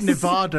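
"Nevada" with a broad "ah" in the middle; Nevada, middle vowel long as in "father"; outsider's pronunciation. Retold or imitated. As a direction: say Nevada as "ne-VAH-da". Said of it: "but we say Nevada".

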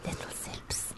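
very soft unvoiced whisper. Real, not acted. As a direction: whisper it playfully. Whispered joke.

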